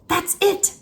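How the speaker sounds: high, bright eureka; excited cry. Acted. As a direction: blurt out the idea. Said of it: "cried Marusia".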